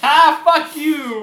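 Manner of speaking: loud, high, slow laughing protest; mock outrage through a laugh; laughing outburst. Real, not acted. Tricked player yells back in laughing outrage.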